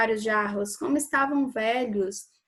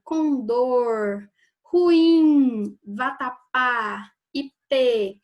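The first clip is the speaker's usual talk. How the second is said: "condor, ruim, vatapá, ipê" each with its final syllable stretched; stress on last syllables; 6 dB louder, much slower than usual. Shown as a demonstration. Teacher listing oxytone examples, last syllable of "condor, ruim, vatapá, ipê" hit hard. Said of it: "quando a última sílaba é a mais forte".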